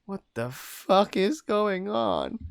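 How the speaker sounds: high, slow, loud squeak; pitiful squeak. A narrator's reading. Captive asks themself what is happening, pitiful squeak. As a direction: squeak it out, drawing the words long. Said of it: "in that pitiful squeak".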